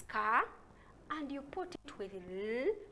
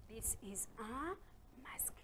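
whispered; 10 dB quieter than usual. Shown as a demonstration. Whispering word to children.

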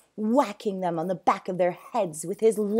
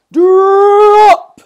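very loud, high-pitched bellow; booming command; 13 semitones higher, 24 dB louder than usual. Acted. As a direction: boom the order across the zoo. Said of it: "boomed Sir Basil".

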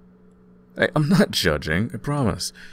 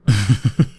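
a bright burst of laughter, louder; 2 semitones higher, 8 dB louder than usual. Acted. As the cold roommate snuggles close, loud laugh. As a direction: laugh out, delighted.

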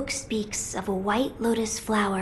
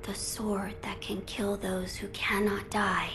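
hushed, breathless whisper of dread; awed whisper; 5 dB quieter than usual. Acted. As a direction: whisper it as if the sword could hear. Awed echo of the blade's name.

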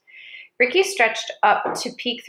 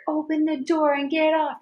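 higher pitched mock scream; 7 semitones higher than usual. A narrator's reading. The largest guard screaming at the man inside. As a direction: yell it at the outhouse door. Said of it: "he screamed".

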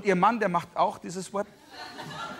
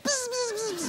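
high, thin buzzing noise like a radar; 4 dB quieter than usual. Pretend. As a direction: buzz like a tiny antenna. GPS buzzing again. (hohes, dünnes Summen wie ein Radar)

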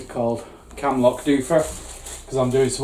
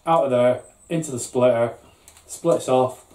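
usual speaking voice

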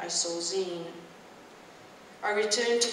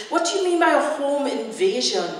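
higher, louder, disbelieving; shocked, raised-voice question; alarmed questioning; 5 semitones higher, 5 dB louder than usual. A narrator's reading. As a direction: demand an explanation at once. Daughter alarmed, demands explanation.

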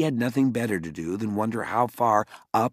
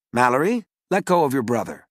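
louder, commanding mother's voice; stern mother; 5 semitones higher, 7 dB louder, faster than usual. Acted. Mother catching her children at night, stern and loud. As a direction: scold from the top of the stairs.

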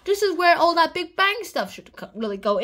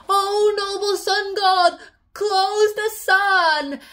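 higher, louder, slow pleading; mock pleading hero; 5 semitones higher, 5 dB louder, much slower than usual. Tintin begging the sun god, slow hammed-up plea. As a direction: beg the sun god grandly. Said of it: "And he's like".

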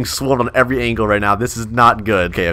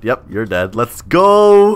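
ordinary talk, nothing marked

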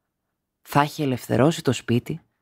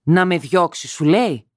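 louder cry of outrage; shout; 5 semitones higher, 4 dB louder than usual. A narrator's reading. Wife shouting at her husband in outrage, louder. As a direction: shout it back at him. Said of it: "του φώναξε".

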